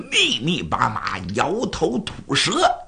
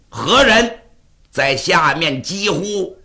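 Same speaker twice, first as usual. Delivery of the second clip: loud challenge; 4 dB louder than usual. Acted. Displeased king demanding who is shouting below, loud challenge. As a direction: call down sharply to an unseen shouter.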